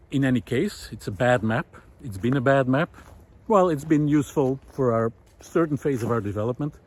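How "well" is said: ordinary delivery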